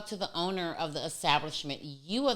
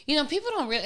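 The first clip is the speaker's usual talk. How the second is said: ordinary delivery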